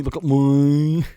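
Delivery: loud, slow, drawn-out "look at me"; mock diva. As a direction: stretch it out like a diva.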